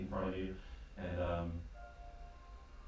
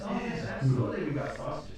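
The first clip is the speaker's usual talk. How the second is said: high, louder bright reply; eager barmaid; 5 semitones higher, 7 dB louder than usual. Acted. Barmaid promising sausages, high and eager. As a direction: cheerfully assure him.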